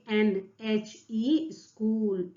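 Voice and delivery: very slow, each letter apart: N H E school; slow spelled answer. Shown as a demonstration. Teacher slowly reading the answer to students. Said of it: "तो आप क्या लिखेंगे".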